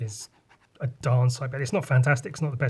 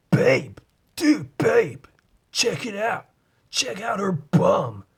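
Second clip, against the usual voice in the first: higher, slow mock American bro voice; mock American frat bro; American dude; 5 semitones higher, slower than usual. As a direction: hype up your buddy like a frat boy. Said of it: "sounds like they're saying it to be funny".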